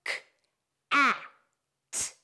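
K, a, t sounded out one by one; segmenting cat sound by sound; tapped sounds. Shown as a demonstration. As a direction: say "K", "a", "t" as separate isolated sounds with pauses. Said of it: "Let's tap the sounds we hear".

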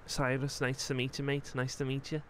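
put-on light Scouse accent as the character Joel; easygoing Liverpool lad in a Scouse voice. Joel chatting with Cyrus, relaxed Scouse accent.

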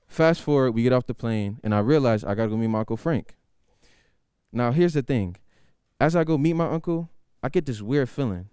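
ordinary delivery

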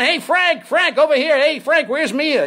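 high, loud call to a star; acted paparazzo shout; yelling photographer. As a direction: holler for attention at arrivals. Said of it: "ad-libbing and yelling at them".

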